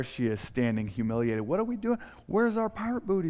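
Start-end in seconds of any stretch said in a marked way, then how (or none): 1.4-3.3 put-on excited kids. Kids at failed checkout.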